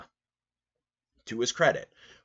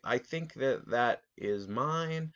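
polite objection; 7 semitones higher, much slower than usual. Retold or imitated. Objecting to lady taking slice.

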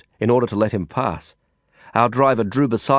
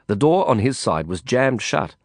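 plain reading voice

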